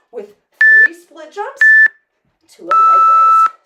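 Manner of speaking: rising, shouted announcement; loud call. Real, not acted. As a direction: call it out high to the room. Coach announces final warm-up move.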